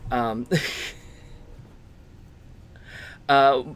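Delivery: normal talking voice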